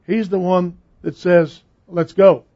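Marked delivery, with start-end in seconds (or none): 1.9-2.4 loud, firm order in Jesus's voice; no-nonsense command. Retold or imitated. Preacher voices Jesus urging believers on, no-nonsense command. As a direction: push it out louder, no coddling. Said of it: "He's not a sweetheart".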